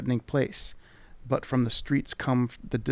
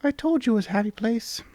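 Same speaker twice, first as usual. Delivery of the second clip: much higher, squeaky sprite voice; charming tiny creature; 10 semitones higher than usual. Acted. Tiny creature charmingly greeting the traveller. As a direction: chirp it like a tiny fairy. Voicing the mini mite.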